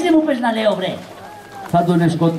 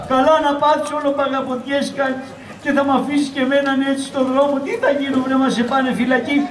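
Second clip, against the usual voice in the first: scolding wife; 2 semitones higher than usual. Acted. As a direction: rail at the good-for-nothing husband. Wife scolding her hunted husband. (γκρίνια συζύγου με ψιλή φωνή)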